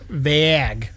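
"Vag" with an open A, as in vaginal; imitated pronunciation. Podcaster copying Lindsay's vague, open-A pronunciation. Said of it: "doing more of a vag".

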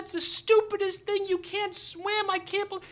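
very high, hysterical scolding; mock screaming. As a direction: imitate a wife's screamed reproach. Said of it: "then she starts screaming".

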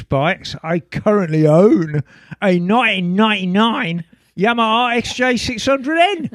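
hammed-up Essex accent. Reading Chris from Essex's email aloud, put-on Essex accent. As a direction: read it out like an Essex bloke. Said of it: "Can you do that accent".

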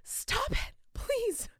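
high, breathy whispered plea; a mock whispered begging; embarrassed hush. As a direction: hiss it at him, mortified. Embarrassed wife hushing her husband.

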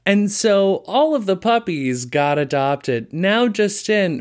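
high, slow upbeat anchor; cheerful newscaster. Retold or imitated. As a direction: chirp it like good news. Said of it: "the reaction time for being like".

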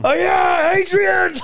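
hammed-up victorious movie shout; Rocky Balboa shout. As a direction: call for Adrian at the top of the stairs. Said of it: "like I was Rocky Balboa".

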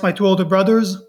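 ordinary delivery

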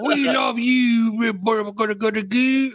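high, drawn-out British gushing; mock British accent. Friends fawning over pregnant friend, mock British cooing. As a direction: coo it in a hammed-up British girl voice. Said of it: "because of the British thing".

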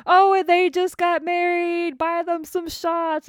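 high mock announcer voice; high hammed-up party MC; DJ announcing. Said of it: "it was just like".

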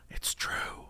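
breathless whispered awe; awed whisper. Retold or imitated. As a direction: whisper it, amazed.

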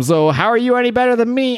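high-pitched, acted challenge; challenging retort. Villain answers the UN's terrorism charge, challenging. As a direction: throw the accusation back, pitched well up.

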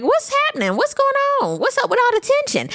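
high put-on voice of a puzzled castmate; imitating Ashley. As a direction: play her as wide-eyed and confused. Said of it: "Ashley then asks Mia, like".

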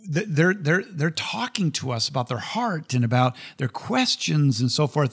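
ordinary delivery

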